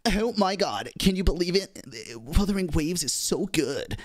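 high-pitched, put-on Cali Girl accent. Streamer told to try a Cali Girl voice, clumsy Cali Girl accent. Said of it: "do a Cali Girl accent".

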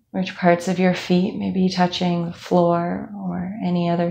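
calm, even meditation voice with long pauses; guided meditation. Acted. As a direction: guide them unhurriedly through a body scan. Leads a body scan meditation.

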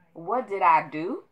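ordinary talk

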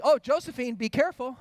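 high, loud mock-caring warning; gentle warning. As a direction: call out a cautionary warning to the child. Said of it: "all I said was just simply".

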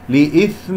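لإثم with light ghunna toward the fa; correct ikhfa. Shown as a demonstration. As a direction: glide the nun of لإثم into fa. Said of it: "فاء، هكذا".

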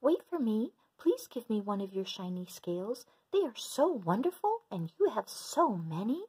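high little pleading voice; small fish voice. Acted. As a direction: beg sweetly in a tiny voice.

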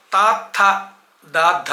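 తాత్థ, దాద్ధ each plain sound then breathy one; careful ta-tha, da-dha for తాత్థ, దాద్ధ; distinct aspirates. Shown as a demonstration. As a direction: separate తాత్థ from దాద్ధ, voiceless then voiced. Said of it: "తేడా లేకుండా పలికేస్తున్నారు".

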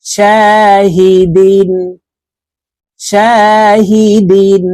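শাহিদিন drawn out slowly, vowels held; slow recitation. Shown as a demonstration. Teacher chanting শাহিদিন for the class. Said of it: "দাল দুই জের দিন".

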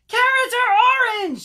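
very high, loud squawk of protest; shouting. Acted. Bird objects to Rabbit's carrot, shouting. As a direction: yell it in outrage. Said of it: "shouted Bird".

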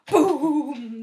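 loud, punchy boom; booming shout. A narrator's reading. Storyteller booming as the goat hits the bridge. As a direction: bang it out like a crash.

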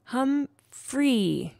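Humphrey in two slow, separated syllables; "Hum... phrey", tentative learner's try; hesitant attempt. A narrator's reading. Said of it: "looked a little frightened, but she tried".

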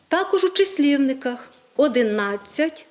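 ordinary talk

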